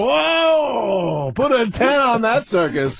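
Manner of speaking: high, drawn-out mock-amazed highlight call; sportscaster call. Comic re-enacting upside-down hockey highlights, excited sportscaster. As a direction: call an absurd replay like a stunned announcer.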